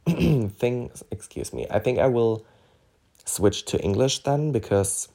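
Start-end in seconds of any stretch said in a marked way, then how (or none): 1.1-1.7 murmured apology after a throat clear; quiet aside. Real, not acted. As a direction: drop to a whispered excuse me.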